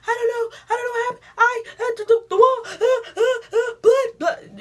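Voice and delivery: high, sobbing, broken blubbering; imitating her crying self, loud and high; hammed-up crying. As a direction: blubber through mock tears. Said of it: "And I'm just like".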